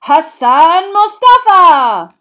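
loud, high and slow stadium announcer; booming announcer. A narrator's reading. Bagman introduces the referee to the crowd with a booming announcement. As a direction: boom it out to the whole stadium.

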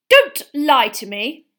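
loud, slow warning; cross mother refusing excuses; stern scolding. Acted. Mother scolding her lying son.

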